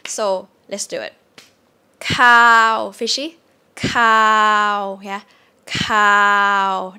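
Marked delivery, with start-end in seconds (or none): 2.0-6.9 "cow" level, slow and loud; mid tone long vowel. Shown as a demonstration. Teacher strings the tones together for comparison. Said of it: "That's a mid tone".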